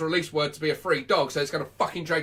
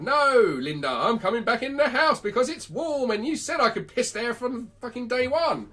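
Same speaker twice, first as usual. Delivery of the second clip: higher-pitched indignant refusal; protesting dog's voice; 7 semitones higher than usual. Acted. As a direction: play a dog refusing to go out in the cold. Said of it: "saying to your dog".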